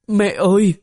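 a high, tearful call for mother; a young girl weeping for her mother; sobbing girl's cry. Acted. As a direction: cry out for mama through tears. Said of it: "nữ đệ tử nức nở, thì thào".

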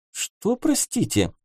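lightly voiced sceptical girl. A narrator's reading. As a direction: question him with open disbelief. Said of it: "В голосе барышни послышался неприкрытый скепсис".

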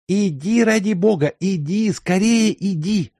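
ordinary delivery

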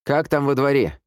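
high-pitched call to someone outside. A narrator's reading. Man in the house asks about the yard, calling out. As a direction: call the question out across the yard.

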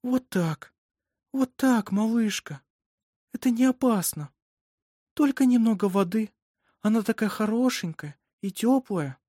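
put-on high, soothing baby talk; tender cooing. A narrator's reading. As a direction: reassure a newborn while bathing her. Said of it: "Это были новые интонации".

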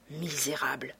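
usual speaking voice

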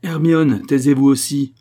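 loud, commanding, high-pitched order; imperious order. Acted. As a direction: silence her with loud authority. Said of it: "dit l'impérieux mari". (ordre impérieux et fort)

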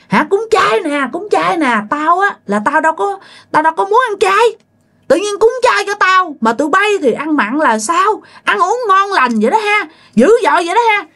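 high-pitched, indignant ghost rant; fierce scolding. Acted. Dead husband in a possessed neighbour scolding the feasting guests, fierce. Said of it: "Mà chửi quá trời chửi luôn".

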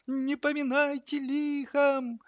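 very high, slow mournful plea; sorrowful farewell. A narrator's reading. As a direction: draw out the last goodbye tragically. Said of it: "горестно вздохнула котлета". (горестно, тонким протяжным голосом)

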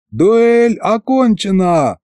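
slow, high-pitched referee's call; yelled announcement. A narrator's reading. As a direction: stretch it out like a ring announcer. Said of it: "заорал я". (протяжный выкрик судьи)

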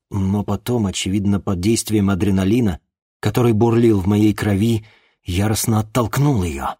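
plain reading voice

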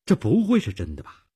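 ordinary delivery, nothing marked

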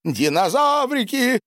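high-pitched gleeful cry; childlike delight. A narrator's reading. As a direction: exclaim like an overjoyed child. Excited Boris waves cutlery. (восторженный высокий возглас)

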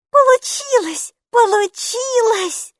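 high, slow, loud joyful cries; overjoyed girl. A narrator's reading. Girl delighted the magic worked, cheering. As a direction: cheer it out as the happy child.

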